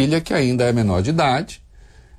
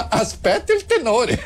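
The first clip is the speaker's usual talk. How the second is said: raised-pitch comic Italian retort; laughing mock-angry baritone; 10 semitones higher than usual. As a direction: play an offended opera singer, breaking into laughter. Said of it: "Aí ele ficou bravo".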